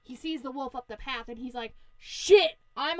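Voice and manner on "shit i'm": acted panic. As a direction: blurt it in mock alarm. Pig spots the wolf.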